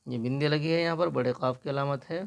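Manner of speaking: ordinary delivery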